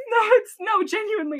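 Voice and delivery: high laughing insistence. Real, not acted. Friend laughs, insisting the fight really happened, laughing. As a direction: laugh while swearing it's true.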